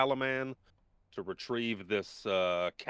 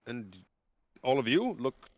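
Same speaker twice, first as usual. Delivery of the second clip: higher, worried noble woman; Marshal voice; 5 semitones higher, 4 dB louder than usual. Acted. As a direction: greet them with alarm.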